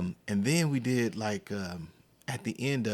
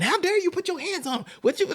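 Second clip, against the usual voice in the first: fast, high-pitched mock scolding; retold outraged rant, rushed and way up high; telling him off; 3 dB louder, faster than usual. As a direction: imitate a mom tearing into an officer. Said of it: "And she gave it to him".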